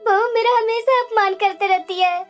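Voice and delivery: wailing grievance between sobs; tearful complaint. Acted. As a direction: cry as you complain to your husband. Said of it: "तुम्हारी आंखों में आंसू क्यों है". (रोते-रोते शिकायत करते हुए)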